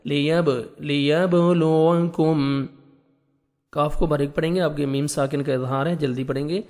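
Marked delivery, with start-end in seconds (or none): 0.1-2.6 لیبلوکم very slow, the با bounced with a double sound; slow syllable-by-syllable drill; qalqalah on با. Shown as a demonstration. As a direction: echo the با in لیبلوکم. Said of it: "با حروف قلقلہ میں سے ہے، double sound آئے گا".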